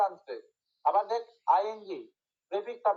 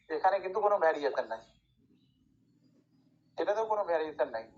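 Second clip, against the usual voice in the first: ordinary delivery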